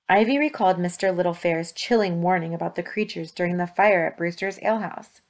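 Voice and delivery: plain reading voice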